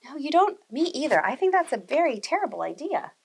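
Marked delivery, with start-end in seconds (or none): none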